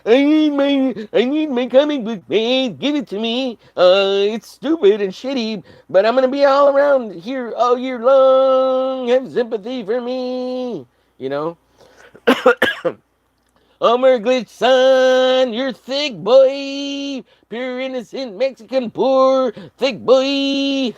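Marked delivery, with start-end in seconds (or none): 0.1-10.9 high whiny voice put on; high hammed-up whiny pleading; mocking childish whine. 13.8-20.9 high, loud put-on voice; hammed-up booming character praise; high hammed-up praising voice; mock character voice.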